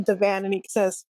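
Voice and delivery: ordinary talk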